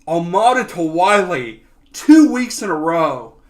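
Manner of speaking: high, loud victory cry; celebratory yelling. Real, not acted. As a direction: shout the replay of the goal, still elated. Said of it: "Sorry if I was yelling".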